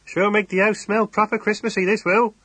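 high, loud rustic farmer's voice calling through the window; a hearty, burly farmer hailing a neighbour; country farmer. Acted. Farmer greets his neighbour Temple at the car window, hearty and loud. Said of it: "he chuckled".

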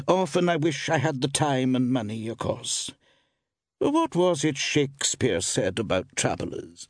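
high woman's musing voice; shopkeeper woman. A narrator's reading. Woman shopkeeper muses to a traveller about travel, high-pitched. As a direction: muse in a high female voice.

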